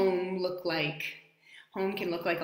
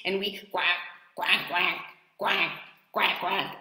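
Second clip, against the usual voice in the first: playful quacking; duck quacks. Shown as a demonstration. Showing children her duck sound, playful quacks. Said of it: "So I get out my little wings".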